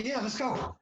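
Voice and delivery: high-pitched, eager agreement; eager energy. Retold or imitated. Husband keen to head upstairs, eager energy. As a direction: imitate a man keen to get going. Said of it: "he's got the energy".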